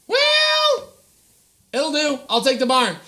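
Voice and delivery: very high squeaky well; squeaky Joseph. Acted. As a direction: squeak it out, then settle. Joseph accepting the barn.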